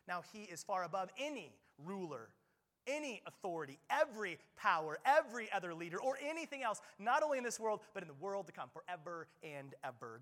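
full-force sermon delivery; preaching at full force. Acted. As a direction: drive it home from the pulpit. Reading scripture with force.

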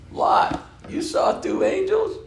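squeaky high falsetto question; hammed-up astonished squeal; mock incredulity. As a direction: shoot the voice way up in disbelief. Sons-in-law disbelieve Lot's story.

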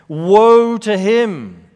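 slow, loud lament, dragging out woe; solemn woe proclamation. Acted. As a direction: toll it like a funeral bell. Preacher proclaims the woe oracle.

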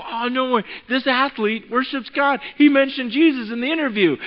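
high, loud, retold amazement; starstruck excitement. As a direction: gush like an overexcited fan.

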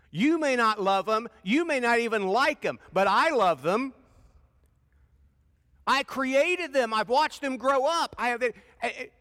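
high, loud impassioned plea; God telling Jonah he loves the Ninevites, loud and high; God pleading. Acted. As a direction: plead like a father who won't give up. Preacher voices God pleading.

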